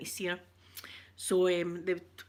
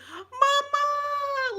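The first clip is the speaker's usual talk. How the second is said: very high, loud squeal of Mama; very high, loud delighted squeal; daughter's cry of joy; 6 dB louder than usual. Retold or imitated. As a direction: squeal it like a child seeing her mum. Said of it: "she went".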